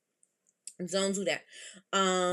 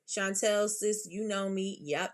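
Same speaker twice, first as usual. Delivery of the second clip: usual speaking voice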